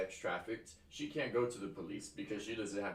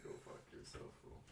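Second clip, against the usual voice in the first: whispered insult, barely voiced; hushed mock taunt; 13 dB quieter than usual. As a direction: breathe the insult out like a sneer. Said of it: "Now my computer's just like".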